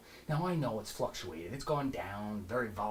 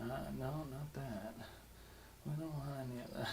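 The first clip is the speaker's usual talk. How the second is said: sleazy hushed come-on; 13 dB quieter than usual. Acted. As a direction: purr it like a creepy late-night charmer. Sleazy soft come-on to ladies.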